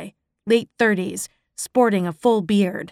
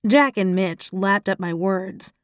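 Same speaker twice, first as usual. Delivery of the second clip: ordinary delivery, nothing marked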